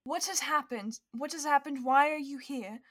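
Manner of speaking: falter, then demand answers in bewilderment; stunned disbelief. Acted. Pippa learning of an interdimensional monster, bewildered.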